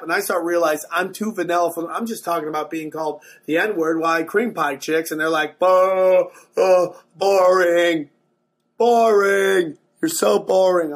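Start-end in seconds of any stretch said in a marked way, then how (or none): 5.6-10.9 loud, drawn-out booing "boring"; heckling crowd. Retold or imitated. Crowd jeering the vanilla comic off stage, loud drawn-out heckle. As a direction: imitate a bored crowd jeering, stretched and loud.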